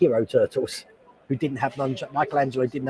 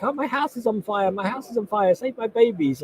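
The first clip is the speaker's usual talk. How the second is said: mock panicked lady; 6 semitones higher than usual. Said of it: "the lady who's like".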